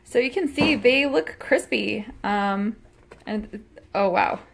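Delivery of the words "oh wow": normal talking voice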